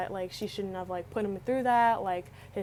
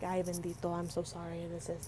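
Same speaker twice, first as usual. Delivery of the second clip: soft sympathy; 3 semitones lower, 6 dB quieter than usual. Retold or imitated. Comforting crying boyfriend.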